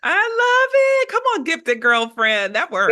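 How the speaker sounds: real, excited whoop easing into hearty approval; delighted cheer. Real, not acted. Host cheers the guest's coined title.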